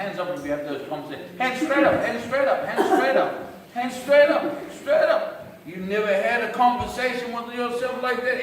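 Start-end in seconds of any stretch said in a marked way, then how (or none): 1.4-5.6 high-pitched repeated command; insistent urging. Real, not acted. Comic urging the crowd to respond, high insistent nagging. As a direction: keep nagging in a raised pitch. Urging audience to raise hands.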